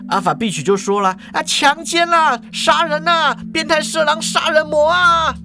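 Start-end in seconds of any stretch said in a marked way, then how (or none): 1.3-5.3 hammed-up cries of outrage; crying for help. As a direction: raise the alarm in a very high voice. Cashier screams for help.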